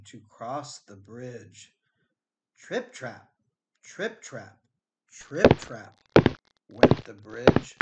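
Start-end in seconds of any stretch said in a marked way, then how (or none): none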